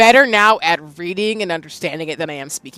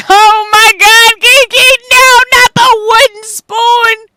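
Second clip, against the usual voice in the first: high, loud, drawn-out mock scream; mock-horrified wail; 12 dB louder, slower than usual. Joking with the chat about granny's discipline, high mock-horrified wail. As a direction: wail it at full volume in exaggerated dread. Mock horror at wooden spoon.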